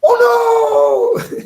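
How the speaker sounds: high, loud panicked squeal; Mister Bill's cry. Retold or imitated. Preacher mocking the fearful, cartoon shriek of panic. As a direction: shriek it like a terrified cartoon clay guy. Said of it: "Mister Bill".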